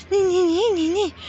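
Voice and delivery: high, loud taunting nee nee; mocking nyah nyah chant. Taunting card voices.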